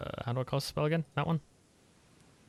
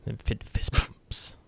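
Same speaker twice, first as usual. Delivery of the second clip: ordinary delivery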